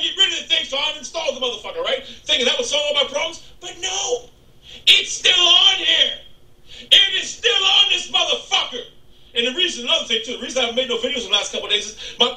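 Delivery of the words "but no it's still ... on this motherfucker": slow, loud, higher disbelief; exasperated. Real, not acted. Vlogger fuming at a program that won't uninstall, slow and loud. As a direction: stretch it out, can't believe it's still there.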